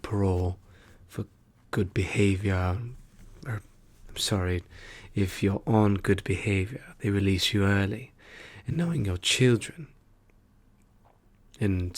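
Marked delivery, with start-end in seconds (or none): none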